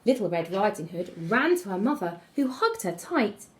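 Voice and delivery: plain reading voice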